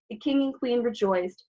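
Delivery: usual speaking voice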